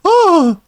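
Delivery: a hammed-up shriek of offense; mock scream at the criticism; mock shocked shriek. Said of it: "the wings gasp".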